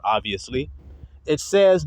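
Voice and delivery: ordinary delivery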